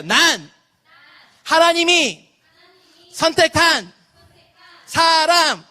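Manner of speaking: high, phrase-by-phrase call-out with pauses; leading a repeat-after-me. Shown as a demonstration. Pastor leading children in a declaration, repeat-after-me call. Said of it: "자, 따라해볼게요".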